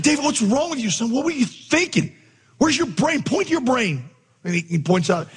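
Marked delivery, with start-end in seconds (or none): 0.0-4.0 very high-pitched, theatrical telling-off; showy scolding. Retold or imitated. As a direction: pile up mock-exasperated questions for onlookers. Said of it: "I want them to hear me say this".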